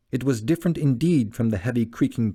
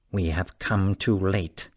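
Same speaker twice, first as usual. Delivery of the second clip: slow, lower, grave statement; stern; 3 semitones lower, slower than usual. A narrator's reading. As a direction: deliver the grim verdict firmly. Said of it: "he said sternly".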